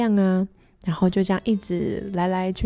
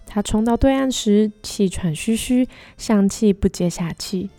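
gentle storybook narration; story narration; 2 semitones higher, 5 dB louder than usual. A narrator's reading. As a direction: read it like a picture book.

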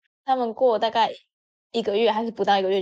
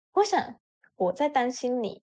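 ordinary delivery

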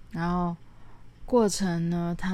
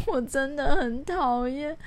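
higher, slow, whiny begging; retelling her crying refusal to climb, higher and slow; tearful plea; 9 semitones higher, slower than usual. Retold or imitated. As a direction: plead through mock tears not to be forced. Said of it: "默默地蹲在那边哭了".

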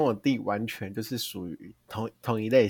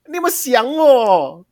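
much higher, louder, slow and drawn out; loud call; 12 semitones higher, 9 dB louder, slower than usual. Retold or imitated. Sister calling to brother as she walks in the door, loud and playful. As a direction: belt it out bursting through the front door. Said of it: "我就会大声说".

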